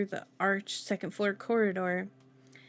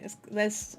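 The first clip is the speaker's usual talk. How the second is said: ordinary delivery, nothing marked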